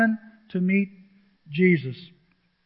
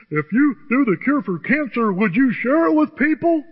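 higher, louder, hammed-up preacher guilt trip; mock preacher; 5 semitones higher, 5 dB louder than usual. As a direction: ham up a pushy preacher's plea. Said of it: "They do things like this to us".

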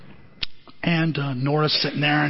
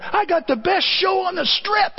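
bragging showman; 12 semitones higher than usual. Retold or imitated. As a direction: sell it like a carnival showman. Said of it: "he bragged about it".

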